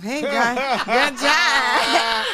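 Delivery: loud, high hammed-up cheer; mock self-praise. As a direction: fake an over-eager compliment to yourself. Mock self-praise in fake comments.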